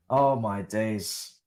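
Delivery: usual speaking voice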